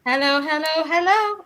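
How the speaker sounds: high-pitched mock CQ call; joking put-on caller. Operator calling CQ on air, joking put-on voice. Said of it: "No, I'm joking. I just talk in my normal Southern accent".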